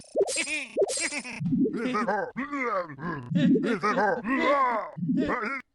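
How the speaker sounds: shrill cackling goblin laugh. Real, not acted. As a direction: cackle like a Clash of Clans goblin. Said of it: "their laugh is reminiscent of Clash of Clans Goblin".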